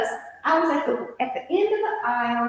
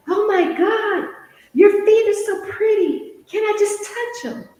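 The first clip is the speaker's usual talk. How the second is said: imitating the man; 7 semitones higher, 4 dB louder than usual. Man voiced in retold encounter.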